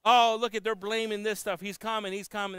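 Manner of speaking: high, loud sneering quote of the mockers; mocking scoffers. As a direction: mimic the scoffers with contempt. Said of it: "they say, they say".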